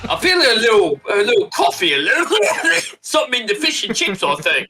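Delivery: put-on a tough British old boy bragging; bad British accent. Bad British accent mocking Wilson. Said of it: "you sound like a horrible".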